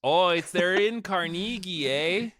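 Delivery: mock Canadian with a perky "eh"; cartoon Canadian accent. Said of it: "just the Canadian accent".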